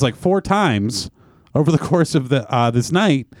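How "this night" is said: ordinary talk, nothing marked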